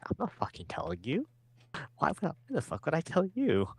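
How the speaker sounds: mock-indignant, rising high; acted-out high, incredulous refusal. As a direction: refuse as if baffled at being asked.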